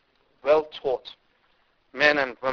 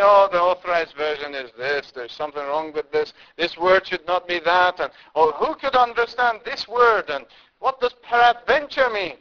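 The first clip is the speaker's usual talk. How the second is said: louder, pitch climbing into a scornful mock complaint; mocking lecturer; 4 semitones higher, 9 dB louder than usual. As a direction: mimic a fussy critic, rising higher by the end. Mocking critical lecturer quoted.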